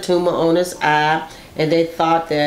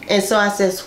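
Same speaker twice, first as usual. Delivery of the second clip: usual speaking voice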